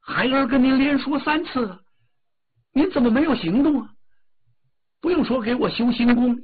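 高声的少年, 委屈转为威胁; 越说越急地向母亲讨要; 哪吒发脾气. Acted. 哪吒向母亲摊牌. (高调急躁的哪吒口吻)

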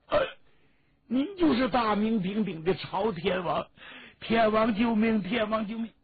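high-pitched, urgent plea to a chieftain; acted monk pleading for rescue, loud and high. Monks beg the chieftain to save them.

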